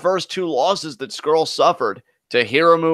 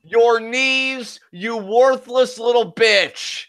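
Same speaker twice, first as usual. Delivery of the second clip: higher, slow contemptuous taunt; cold belittling sneer; 6 semitones higher, slower than usual. Pretend. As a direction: spit the insult down at him slowly. Mock trash talk at wrestler.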